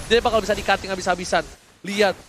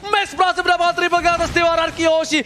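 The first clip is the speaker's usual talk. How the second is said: fighting game announcer; 8 semitones higher than usual. Acted. Hyped play-by-play during teamfight.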